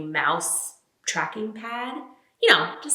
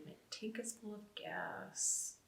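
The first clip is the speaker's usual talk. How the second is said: whisper; 2 semitones higher, 21 dB quieter, slower than usual. Acted. Listing prepper supplies, deadpan.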